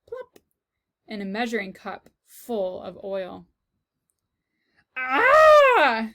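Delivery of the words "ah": high, very loud scream of shock; Cook's startled cry; shriek. Acted. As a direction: shriek at the sight of a mouse. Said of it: "said Cook".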